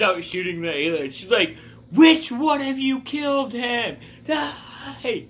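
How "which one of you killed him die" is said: mock battle rage. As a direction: charge in screaming for revenge. Old lady shooting aliens, raging.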